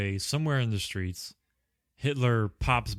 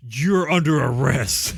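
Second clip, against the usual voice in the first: loud, hammed-up cop bark; mock furious arrest; 3 semitones higher, 8 dB louder than usual. Friends retelling a movie, the hero yelling at the cobra in a mock furious shout. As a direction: yell the arrest like an angry action hero.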